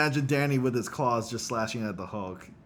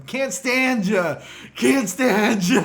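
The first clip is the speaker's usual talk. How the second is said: loud, high, drawn-out heckle; mock shout, stretching every word out; imitating sitcom coach; 9 semitones higher, 10 dB louder, much slower than usual. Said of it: "mispronouncing his last name".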